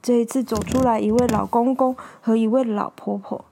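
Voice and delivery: ordinary delivery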